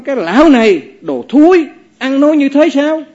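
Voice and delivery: high, loud furious insult; angry outburst. Acted. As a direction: explode at the old monk. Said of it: "ông nổi sân liền". (quát giận dữ, cao và to)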